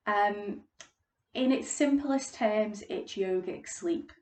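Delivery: usual speaking voice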